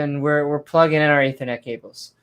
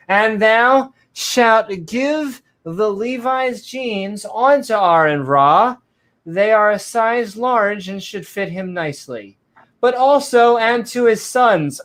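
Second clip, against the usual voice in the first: 1 mock scripture; 5 semitones higher, slower than usual.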